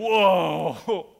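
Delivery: loud startled Whoa at the surrounding army; astonished whoa. Retold or imitated. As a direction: blurt it out, stunned by what you see. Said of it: "he walks out and he goes".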